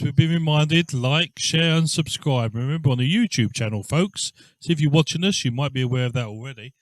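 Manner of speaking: booming like, share, subscribe call; loud announcer. Real, not acted. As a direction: project it like a stadium announcer. Reminding viewers to like and subscribe.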